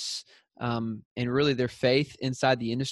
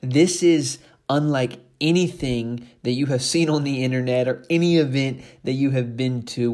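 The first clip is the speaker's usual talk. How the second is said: upbeat, louder promo pitch, pushing the tickets; ad read; 5 dB louder than usual. Acted. Host promoting the summit to the community, punchy ad read. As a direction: sell the summit like a radio spot.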